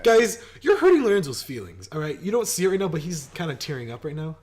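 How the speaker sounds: loud, high-pitched pleading to the viewers; exaggerated protest on a friend's behalf; mock scolding. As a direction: scold the audience with hammed-up concern.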